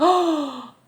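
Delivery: short gasped oh; mock gasp of surprise. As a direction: react as if caught off guard.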